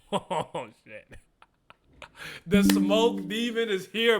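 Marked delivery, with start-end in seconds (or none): 2.4-4.2 higher, louder, slow hype call; excited announcement. Real, not acted. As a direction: announce the demon's arrival. Announcing villain's arrival excitedly.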